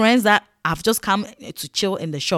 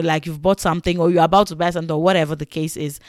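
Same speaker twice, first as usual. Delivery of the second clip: usual speaking voice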